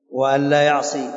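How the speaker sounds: normal talking voice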